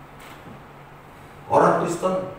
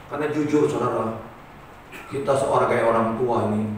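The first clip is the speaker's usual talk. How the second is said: ordinary delivery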